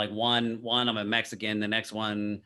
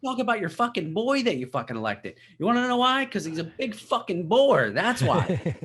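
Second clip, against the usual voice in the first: high, ranting comic-outrage voice pitched way up; Bill Burr impression; 10 semitones higher, 4 dB louder than usual. Podcaster imitating Bill Burr ranting at CNN, high and fired up. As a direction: rant like a worked-up stand-up comic. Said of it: "that wasn't the best Bill Burr".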